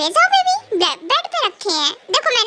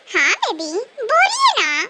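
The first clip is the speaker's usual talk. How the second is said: ordinary delivery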